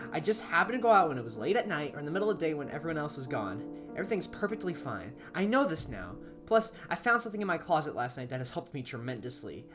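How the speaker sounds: usual speaking voice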